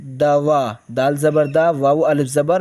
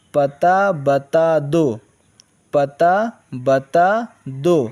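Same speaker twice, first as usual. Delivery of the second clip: slow dictation; slower than usual. Shown as a demonstration. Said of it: "teacher reads slowly".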